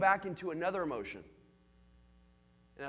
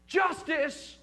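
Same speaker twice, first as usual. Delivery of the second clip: righteous cry; 11 semitones higher, 9 dB louder than usual. Shown as a demonstration. Naming fighting urge.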